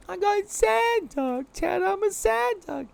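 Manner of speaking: very high, slow, whimpering little duck; sad duck voice. Pretend. Showing chat the sad duck emote in a whimpery voice. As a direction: pout it out like a sad duckling.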